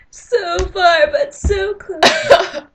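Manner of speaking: high, slow, laughing lament; mock despair. Student reaches for dropped pencil, melodramatic lament. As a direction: stretch it out into a comic wail.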